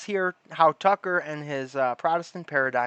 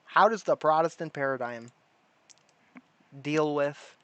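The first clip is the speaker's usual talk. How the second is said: usual speaking voice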